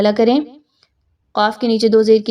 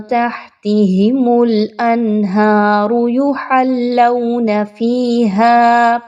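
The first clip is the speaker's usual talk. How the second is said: slow melodic tajweed chant; Quranic recitation; much slower than usual. Shown as a demonstration. Teacher reciting the verse to students, melodic chant. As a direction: draw out the long vowels in a steady chant.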